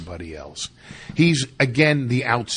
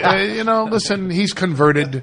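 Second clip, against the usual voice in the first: put-on laughing Yiddish accent, higher; 7 semitones higher, 7 dB louder than usual.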